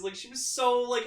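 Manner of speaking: ordinary talk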